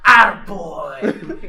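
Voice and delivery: a loud, very drawn-out cheer; slow shouted attaboy. Retold or imitated. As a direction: bellow it proudly and slowly. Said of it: "he goes".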